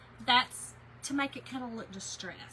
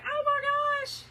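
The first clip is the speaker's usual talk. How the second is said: high, loud imitated squeal of oh my gosh; a friend's delight, copied much higher and louder; imitating excited friend; 8 dB louder, slower than usual. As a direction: play the friend gushing at church. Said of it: "she was so excited".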